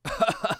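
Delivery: high, soft eerie cackle; weird laugh. A narrator's reading. Cult leader answers the threat with a weird laugh. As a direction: burst into a brief strange laugh, pitched high. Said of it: "bật lên tiếng cười quái gở".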